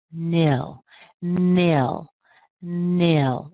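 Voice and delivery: "nil" drawn out and strong; stressed "nil" shown on its own; long strong syllable. Shown as a demonstration. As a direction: stretch each "nil", with a pause between. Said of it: "It's a little bit longer".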